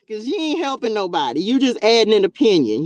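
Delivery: loud, scolding call-out; loud preacher rebuke. Real, not acted. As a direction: scold the critics. Rebuking critics.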